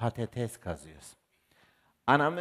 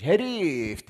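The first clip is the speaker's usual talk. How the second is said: a loud, higher village mother's call; shouted pet name; mock yell; 5 semitones higher, 7 dB louder than usual. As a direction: bellow it across the field.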